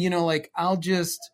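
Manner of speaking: normal talking voice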